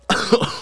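sudden dry cough mid-sentence; a real choking cough; coughing fit. Real, not acted. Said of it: "I'm choking here".

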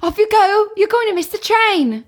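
high, bright British usher call; high sing-song platform warning; chirpy usher. Retold or imitated. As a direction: hurry the children onto the train. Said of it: "You say".

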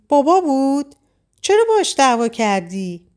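sulky frown. A narrator's reading. Little girl questions her mother about the call, sulky. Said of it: "با اخم از مامان پرسید".

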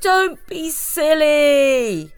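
slow, high teasing singsong; mocking chorus. A narrator's reading. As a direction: tease the scared mice. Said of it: "said some of the mice".

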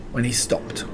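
usual speaking voice

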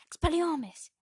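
loud high spell cast; shouted spell. Acted. Casting a disarming spell.

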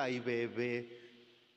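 put-on baby him like a doting mom; coddling mommy. Preacher imitating a mother spoiling her grown son, doting coo.

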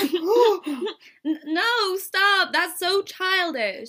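high, loud complaint; acted protest. [name] objects to Oliver's childish game, protesting.

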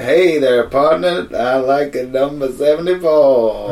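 loud cowboy American drawl; mock American twang, drawn out; hammed-up American accent. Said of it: "the U S they say".

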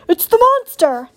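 high, loud alarmed screech; startled owl's loud cry. A narrator's reading. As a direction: screech a warning to the whole crowd. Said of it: "Swooper fluttered up from his branch and screeched".

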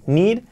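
"Need" with a long EE vowel; "Need" lifted, with a clear EE; "Need" with I sound. Shown as a demonstration. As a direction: say "Need" like Spanish "nid". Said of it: "suena como una I".

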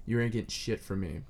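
ordinary delivery

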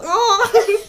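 very high, loud yelp; hammed-up cry from being beaten; mock yelp of pain. Swordsman beaten up again.

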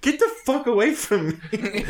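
high-pitched, laughing protest; half-laughing, worked-up objection; mock indignation. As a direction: retell shooing off a snooper. Retells snapping at nosy coworker.

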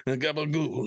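high, hammed-up Italian-American accent stretching gabagool; mock Italian-American accent. Echoing the cohost's joke, in a mock Italian-American accent. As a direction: echo the mob-movie accent with a laugh.

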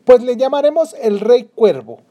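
high, loud mocking laugh in the voice. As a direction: laugh heartily while naming him. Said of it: "rió la princesa de buena gana".

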